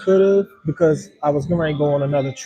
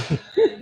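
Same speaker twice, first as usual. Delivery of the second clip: high laugh. Real, not acted. Laughs over the latte.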